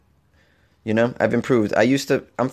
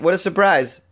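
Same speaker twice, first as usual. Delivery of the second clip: usual speaking voice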